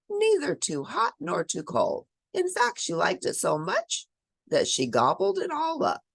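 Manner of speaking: usual speaking voice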